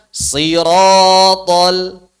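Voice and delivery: siratal with thick, rounded vowels, very slow; heavy siratal. Shown as a demonstration. Teacher models siratal for students to repeat. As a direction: draw out siratal slowly with a heavy sound.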